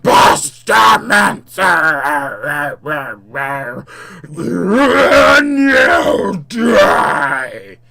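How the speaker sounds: slow, shouted monster curse; full-force demon voice; demonic howl. Acted. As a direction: roar it with long stretched vowels. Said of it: "open and howl in unison".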